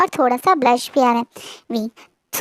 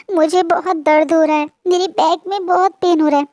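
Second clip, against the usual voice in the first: put-on higher, tearful childlike whimper; crying like a child; 4 semitones higher, 3 dB louder than usual. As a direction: plead through tears like a hurt little kid. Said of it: "रोते हुए अपने आंसू को साफ करते हुए बोलता है". (रोते हुए, बच्चे जैसी ऊँची आवाज़ में)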